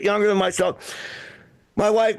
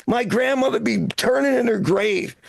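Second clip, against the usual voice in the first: ordinary delivery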